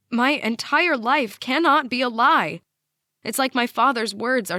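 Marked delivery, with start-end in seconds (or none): 0.1-2.6 louder, higher, every word of "entire life cannot" punched; emphatic denial. A narrator's reading. Daughter refuses to believe the news, emphatic denial. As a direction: insist on it, stressing "entire life cannot be a lie". Narrator's shocked inner denial.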